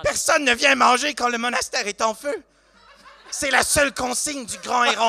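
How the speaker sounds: high, loud character declaration; stern NPC voice. Acted. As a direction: proclaim it like a zealous monk. NPC warns about monastery.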